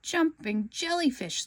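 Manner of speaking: high, slow startled cry; screamed surprise. A narrator's reading. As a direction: yelp it out in shock. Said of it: "SpongeBob screamed".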